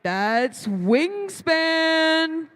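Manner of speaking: drawn-out hype announcer call; fighting game announcer. Acted. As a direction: stretch the team name out slowly like a ring announcer. Hyped team intro at robotics match.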